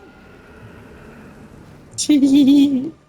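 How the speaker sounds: long giggling laugh; loud high laugh. Real, not acted. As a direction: burst of high-pitched laughter. Laughing at game line.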